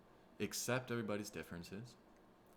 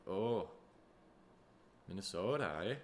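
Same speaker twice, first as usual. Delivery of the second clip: put-on playful Minnesota accent; lightly mocked Minnesota lilt; Minnesotan. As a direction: play a homely Minnesotan. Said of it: "Some people from Minnesota are".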